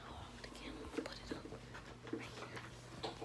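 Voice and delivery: very soft hushed whisper. Real, not acted. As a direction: barely breathe the words. Whispering beside sleeping target.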